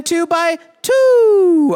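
high, loud, long-held final "two"; hammed-up hype. Real, not acted. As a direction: stretch the last "two" into a hooting call. Hyping the Noah fact.

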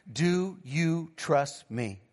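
a grave, unhurried retelling of God's voice; slow, solemn question. Retold or imitated. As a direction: softly pose it word by word. God's solemn question, retold by father.

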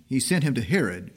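usual speaking voice

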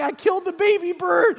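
high-pitched comic wail; mock-crying daughter. Daughter fooled by the turkey prank, mock crying. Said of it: "And she's crying".

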